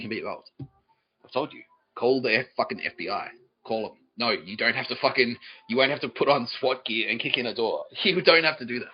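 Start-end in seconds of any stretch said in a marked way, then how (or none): none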